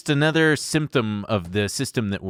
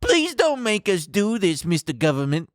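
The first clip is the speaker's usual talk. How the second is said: whiny executive; 7 semitones higher than usual. Retold or imitated. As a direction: beg like a spoiled exec. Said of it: "That part's me adding a little color".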